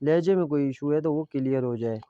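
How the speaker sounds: usual speaking voice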